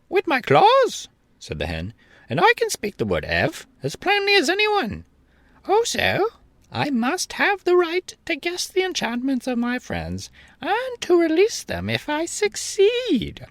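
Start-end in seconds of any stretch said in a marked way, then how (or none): 0.1-1.1 hen voice. A narrator's reading. Billina voiced. 2.3-13.5 hen voice. A narrator's reading. Billina voiced.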